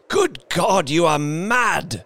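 high, horrified exclamation; aghast cry. A narrator's reading. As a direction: cry out appalled.

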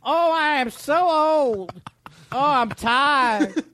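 put-on high, slow trembling old man voice. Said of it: "one of your funny voices".

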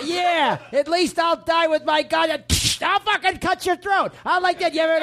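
high, hammed-up shout back; old band member screaming at the singer; shouting old musician. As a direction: yell back like a crusty old player. Old bandmate yelling back.

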